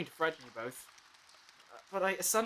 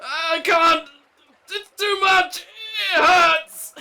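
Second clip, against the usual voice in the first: much higher, louder anguished cry; crying out in pain; 12 semitones higher, 15 dB louder, much slower than usual. Acted. Two crying out in pain to the Doctor.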